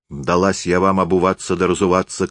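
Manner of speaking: plain reading voice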